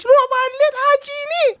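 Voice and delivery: high put-on girlfriend voice, hammed-up; high, hammed-up jealous girlfriend; mock huffy girlfriend. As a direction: play the sulking alien girlfriend brushing him off. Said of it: "فهي قالت له".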